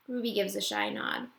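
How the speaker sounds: ordinary delivery, nothing marked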